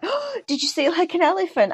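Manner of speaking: lightly voiced dad guessing an elephant; dad's voice. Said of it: "He was like".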